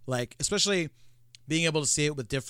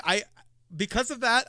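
ordinary talk

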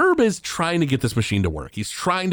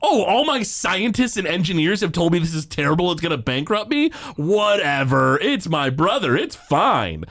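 excited insistence; 7 semitones higher than usual. Retold or imitated. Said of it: "Herbert Powell's like".

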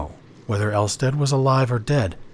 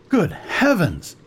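exclaiming; 8 semitones higher, slower than usual. A narrator's reading. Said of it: "he exclaimed".

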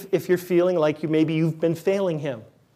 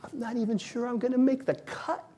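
inner doubtful voice; 6 semitones higher, 7 dB quieter than usual. Retold or imitated. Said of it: "there's a part of me that goes".

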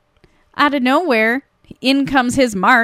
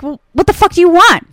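higher, louder mock irritation; pissed-off woman; 5 semitones higher, 6 dB louder, faster than usual. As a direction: imitate her snapping at him. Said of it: "She's all pissed at him".

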